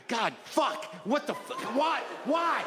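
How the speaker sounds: rising frustrated cry, climbing high to a shout; exasperated outburst. Retold or imitated. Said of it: "and then I'm like".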